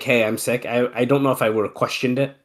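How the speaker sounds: ordinary delivery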